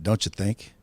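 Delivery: ordinary talk, nothing marked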